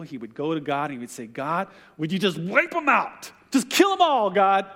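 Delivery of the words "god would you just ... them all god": angry demand. Retold or imitated. Moses fed up with the people, begging God in fury. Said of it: "Moses would be so mad at the people".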